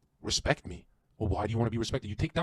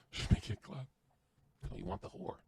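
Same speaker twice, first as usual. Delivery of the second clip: whispered; 8 dB quieter than usual. Retold or imitated. Whispered devil voice.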